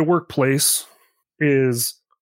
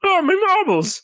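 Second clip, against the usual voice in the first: mock British accent. Mocks the character's accent.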